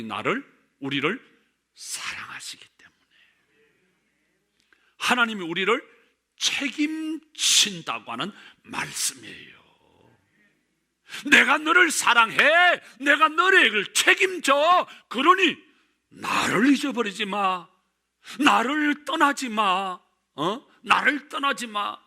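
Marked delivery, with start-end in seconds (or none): 1.7-3.0 breathy hushed whisper, much softer. Real, not acted. Preacher whispering God's love to the congregation. 11.3-22.0 loud, higher pleading voice of God; voicing God. God pleading with his people, loud and high.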